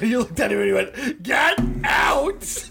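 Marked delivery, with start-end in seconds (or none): 1.2-2.6 high shout to get out; furious clerk yelling get out; shouting him out. Retold or imitated. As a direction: throw the kid out with a yell. Ordering the kid out.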